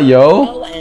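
usual speaking voice

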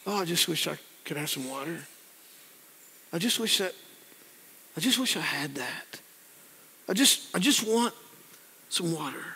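very soft, wistful wishing; hushed retold yearning; sincere longing. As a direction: wish it quietly, haltingly, with no hinting. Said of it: "David's not being a passive aggressive".

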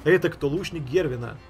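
ordinary talk, nothing marked

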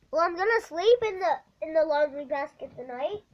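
loud, high announcement to a parent; calling out. Real, not acted. Kid telling Dad the sleeping plan, calling out. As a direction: call it out well above talking level.

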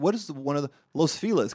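usual speaking voice

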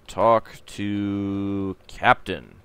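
very slow, spelled-out command voice; typing command. Acted. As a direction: read the command as you type it. Reads typed game commands aloud.

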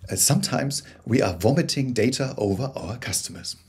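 ordinary delivery

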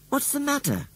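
put-on high, small girl's voice; lightly voiced girl, pitched right up; concerned girl. A narrator's reading. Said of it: "she asked".

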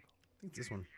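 whispered aside. Real, not acted. Unsure which door, whispering to his friend. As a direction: breathe it out unvoiced.

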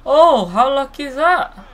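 high, loud disbelieving cry; excited shout. Real, not acted. Repeated louder exclamation.

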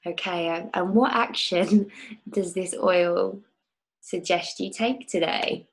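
calm guide leading a visualization; guided meditation. Acted. Leads a guided oil meditation.

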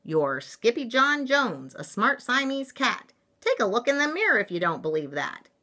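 emphatic. Acted. Storybook mama corrects her kitten.

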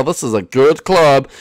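ordinary delivery

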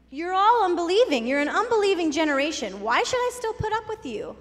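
Jesus scolding an unbelieving crowd, exasperated; annoyed Jesus. Acted. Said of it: "I think he was getting a little annoyed".

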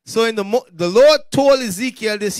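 normal talking voice

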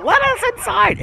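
very high voice for the little dogs; pleading dog voice. Pretend. Speaking for cold dogs wanting in, high pleading voice. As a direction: squeak it as the dogs begging. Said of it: "They're like".

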